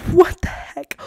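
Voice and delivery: a stunned little whisper; disbelieving whisper. Real, not acted. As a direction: breathe it out in disbelief.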